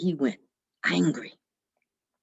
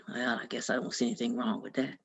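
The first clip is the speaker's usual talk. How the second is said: much lower and softer sly devil; 10 semitones lower, 8 dB quieter than usual. Acted. The devil agrees to Jack's coin trick, low and soft. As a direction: murmur it low and gullible.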